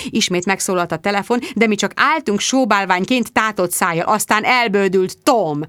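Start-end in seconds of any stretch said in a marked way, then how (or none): none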